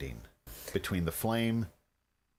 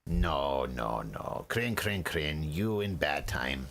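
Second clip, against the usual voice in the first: low, slow goblin warning; lightly voiced goblin menace; goblin prisoner; 5 semitones lower, slower than usual. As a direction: warn him gravely as a goblin.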